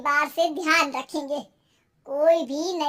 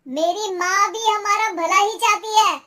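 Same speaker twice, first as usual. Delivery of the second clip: much louder, higher indignant retort; angry shouting; 6 semitones higher, 10 dB louder than usual. Acted. Husband defends his mother to wife, shouting angrily. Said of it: "चिल्ला क्यों रहे हो".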